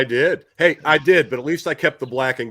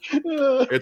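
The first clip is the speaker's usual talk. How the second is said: losing it laughing; 14 semitones higher than usual. Real, not acted. Host losing it over co-host's photos, helpless laughter. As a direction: squeal the words out through helpless laughter. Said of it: "I'm getting dangerously close to the edge".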